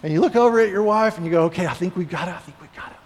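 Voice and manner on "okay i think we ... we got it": whisper. Retold or imitated. Whispering to wife, kids asleep.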